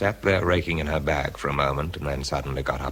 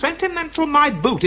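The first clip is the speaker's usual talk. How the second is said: scornful retort. Acted.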